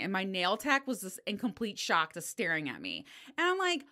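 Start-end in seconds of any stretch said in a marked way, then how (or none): none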